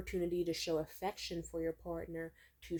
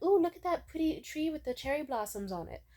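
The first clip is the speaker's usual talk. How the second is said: higher, excited oohing over something pretty; excited pointing-out; 8 semitones higher than usual. Acted. Partner pointing out a pretty tree, excited. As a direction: point it out with eager delight, pitched up.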